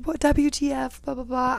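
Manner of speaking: high-pitched mock outrage; imitating yelling girl. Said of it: "This girl starts yelling".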